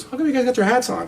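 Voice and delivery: high-pitched puzzled question; imitated puzzlement. Partner asks squad about hats, high retold puzzlement. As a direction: imitate her asking, voice pitched right up.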